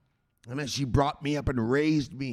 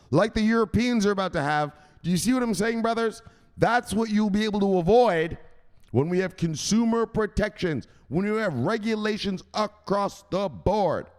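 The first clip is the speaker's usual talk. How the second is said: preaching; 7 semitones higher, 4 dB louder than usual. Acted. Preaching to brothers.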